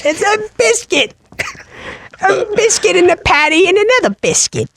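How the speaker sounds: very high, squeaky British caricature; British accent. Acted. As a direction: squeak it like a cartoon Brit. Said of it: "the British person comes over".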